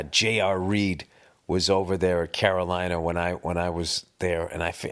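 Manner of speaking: ordinary talk, nothing marked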